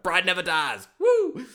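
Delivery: loud, high hype shout ending in a whoop; amped-up cheer. Real, not acted. Host getting hyped about Pride for his listeners, loud cheering whoop. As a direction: yell it like a fan at ringside. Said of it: "it gets super hot, super amped".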